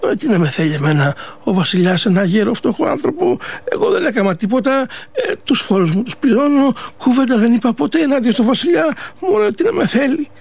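worried and bewildered, pleading his innocence; scared old man. Acted. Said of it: "τον έπιασε... ψηλή τρομάρα".

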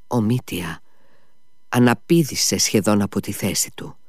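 ordinary delivery

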